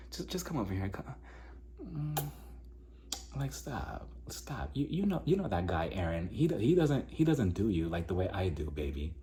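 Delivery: very soft, hushed wooing imitation; mock coaxing. Ex luring her back home, hushed coaxing. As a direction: coax quietly, almost under your breath.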